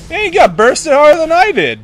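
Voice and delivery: high, loud amazed exclamation; loud incredulity. Real, not acted. Gamer amazed at teammate's death, loud high disbelief.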